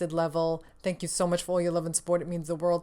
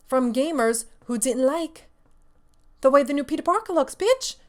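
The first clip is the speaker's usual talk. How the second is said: higher and louder, fast, climbing to a shriek on bitch; outraged rise; 7 semitones higher, 4 dB louder than usual. Real, not acted. Host rants at gamers who threatened a developer, outraged. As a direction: build it up into an indignant yelp.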